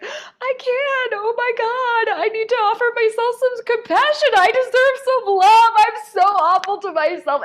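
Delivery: high, loud, breaking sobbed words; weeping. Retold or imitated. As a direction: sob it out, voice cracking high and loud. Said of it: "And I began weeping".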